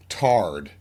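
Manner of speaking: "Tarred" said to rhyme with "hard", low and falling; Southern pronunciation. Shown as a demonstration. Showing grandmother's word for tired, Southern "Tarred" with no long i. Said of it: "Tarred meant tired".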